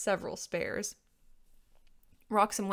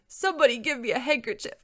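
loud, higher dragon plea; allergic dragon; 5 semitones higher, 6 dB louder than usual. A narrator's reading. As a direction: demand help before the next sneeze. Sneezing dragon demands handkerchief.

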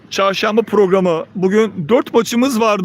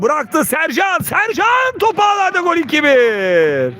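rising, much higher goal shout; excited goal call; 5 semitones higher than usual. Real, not acted. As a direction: build to a goal scream. Goal call in live commentary.

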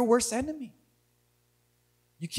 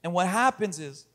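low, hushed preaching with long pauses; solemn pastoral appeal; 11 semitones lower, 6 dB quieter than usual. Real, not acted. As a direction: speak softly and gravely to a hurting congregation. Sermon turns to soft, reflective appeal.

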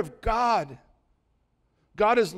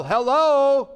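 1 hello; 7 semitones higher, 6 dB louder than usual. Real, not acted. Preacher calls out to congregation.

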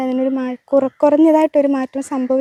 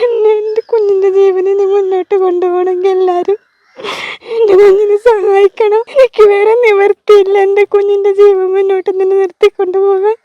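crying while pleading, voice raised; real tearful plea; 3 semitones higher, 4 dB louder than usual. Real, not acted. Mother pleading for her child's life, crying. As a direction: beg through real sobs.

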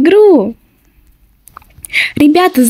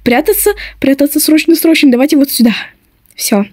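usual speaking voice

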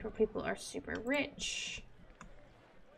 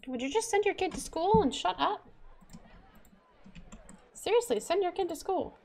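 exasperated rant; 8 semitones higher, faster than usual. Real, not acted. Gamer scolding citizens who won't attend school, high exasperated rant. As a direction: scold the whole city at once.

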